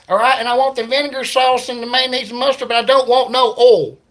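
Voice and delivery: mock hillbilly ordering through the speaker. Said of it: "redneck guy".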